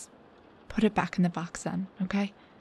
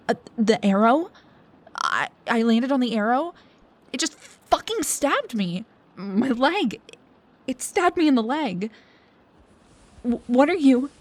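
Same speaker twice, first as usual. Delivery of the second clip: panicked pain; 3 semitones higher, 5 dB louder than usual. Acted. Panicking after the arrow stabs her.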